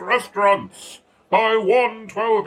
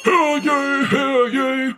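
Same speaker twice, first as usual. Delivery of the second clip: town crier; 4 semitones higher, slower than usual. Pretend. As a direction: bellow it like a medieval town crier.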